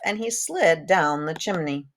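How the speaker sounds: plain reading voice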